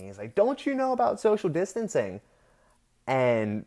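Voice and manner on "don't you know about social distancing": scolding high-pitched imitation; mock holler. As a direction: imitate an indignant man calling across the street. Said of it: "he hollers across the street at me".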